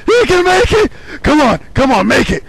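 high, loud shouted countdown; frantic cheering shout. Real, not acted. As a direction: yell the numbers down to the finish. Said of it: "I started screaming a little bit much".

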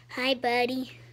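ordinary talk, nothing marked

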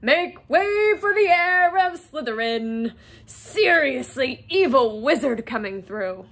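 loud, drawn-out mock announcement; mock herald's shout. A narrator's reading. Fred and George clearing the corridor for Harry, shouting.

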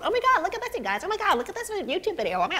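high, squealing mock gush over a phone video; imitating excited coworker. Mocking a castmate who shows everyone videos, high excited gush. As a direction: play the giddy castmate thrusting her phone at everyone. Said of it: "She drive me nuts because she'll always have her phone".

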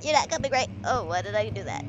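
ordinary delivery

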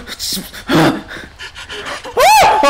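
sharp high gasp; startled gasp. Real, not acted. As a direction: gasp in fright. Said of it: "the door scared me".

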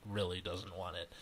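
plain reading voice

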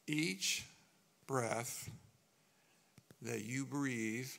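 ordinary talk, nothing marked

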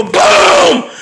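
long, drawn-out "boom"; mock explosion shout; imitated explosion. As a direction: bellow it like a blast. Voices the explosion again.

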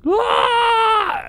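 sharp high cut-off scream; curdling scream. Acted. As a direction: shriek then cut short.

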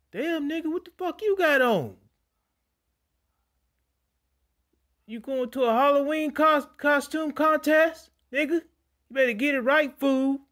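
high, loud squealing mock voice; mocking onlooker. Bystanders mocking the released man's seventies clothes in a high loud voice. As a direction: shriek at his outfit in disbelief. People mocking his outfit.

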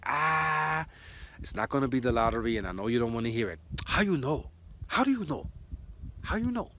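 imitating Japanese lady. Restaurant lady stunned at the psychic's answer, imitated high and loud in Japanese accent.

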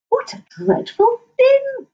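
drawn-out horrified warthog; high, slow complaint. Acted. Warthog to the noisy birds, horrified and slow. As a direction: complain about the racket, slowly.

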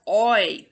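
loud drawn "Oi" with a rounded diphthong; vowel drill. Shown as a demonstration. Coach isolating the oil vowel for students. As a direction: open into the "Oi" fully. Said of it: "we want to practice vowels".

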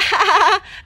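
high, rapid laughter; real giggly ha-ha-ha; amused laugh. Real, not acted. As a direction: burst out laughing at the reveal. Amused by the reveal.

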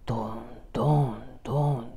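low, softened repeated thuds; imitated stamping. Storyteller imitating stamping outside the door, low soft thuds. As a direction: thud softly, marking time. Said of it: "何か足踏みでもしてるみたいに".

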